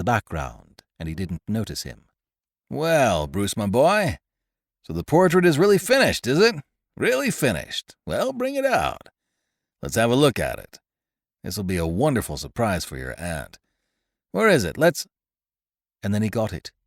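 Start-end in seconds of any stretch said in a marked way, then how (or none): 2.7-4.2 put-on hearty old uncle. The uncle arrives to inspect the portrait, hearty. 4.8-6.6 higher and louder, pleased; eager. Acted. The uncle greets Bruce about the portrait, eager. 7.0-15.1 put-on hearty old uncle. The uncle arrives to inspect the portrait, hearty.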